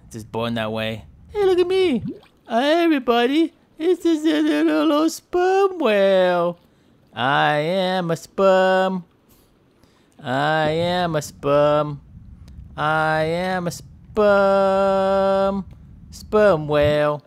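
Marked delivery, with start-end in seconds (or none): 1.3-6.6 high, loud sing-out, last words drawn out; put-on high voice hailing everybody; mock self-introduction. Whale introducing himself to everybody, high mocking voice. 7.2-17.2 very slow, long-held words; mock proclamation. Whale announcing that he is a sperm, slow mock proclamation.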